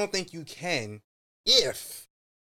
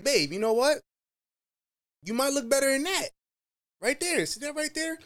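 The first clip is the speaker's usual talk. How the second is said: put-on coaxing boyfriend; 8 semitones higher than usual. Boyfriend voicing tactful advice to girlfriend.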